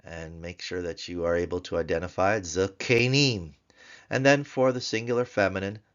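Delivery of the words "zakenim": "Zakanim" as za-KAY-nim, long middle vowel, louder; drawn-out tsere. Shown as a demonstration. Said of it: "Get that sere out".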